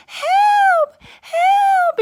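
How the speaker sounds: very high, loud shriek for help; screaming. A narrator's reading. Goldilocks fleeing the three bears, panicked scream. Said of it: "and she screamed".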